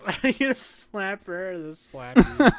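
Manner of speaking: high, laughing repeat; laughing echo. Real, not acted. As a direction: echo it while cracking up.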